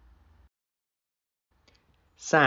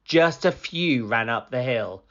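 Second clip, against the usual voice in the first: usual speaking voice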